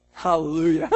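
loud hallelujah bursting into a laugh. Real, not acted. Preacher telling the congregation about her death, laughing exclamation. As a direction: cry it out with a laugh.

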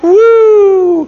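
loud high "woo"; long whooping sports-fan cheer; ball-game cheer. Acted. Mimicking a fan at ball games, loud whoop. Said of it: "this crying out isn't like they were like".

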